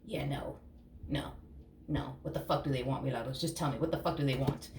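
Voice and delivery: low, quiet, halting brush-off; acted low-voiced, unconvinced pushback; reluctant refusal. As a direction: turn it down under your breath. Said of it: "and you're just like".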